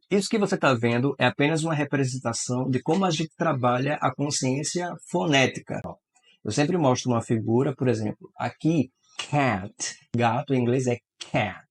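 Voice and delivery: usual speaking voice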